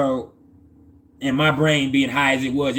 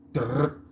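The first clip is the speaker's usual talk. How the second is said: soft rolled drrr; imitated card riffle; 5 dB quieter than usual. Storyteller mimics the tarot cards' noise, soft rolling trill. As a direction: roll out a short, soft trill.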